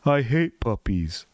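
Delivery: slow, flat-out cat grumble; deadpan Garfield. Pretend. Garfield to the camera, slow deadpan. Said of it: "Garfield's looking at the camera saying".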